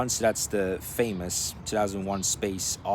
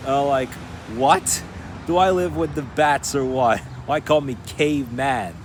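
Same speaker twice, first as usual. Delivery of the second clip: incredulous; 5 semitones higher, 5 dB louder than usual. Real, not acted. Outraged at caveman remark.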